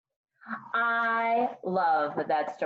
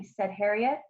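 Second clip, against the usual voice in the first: ordinary delivery, nothing marked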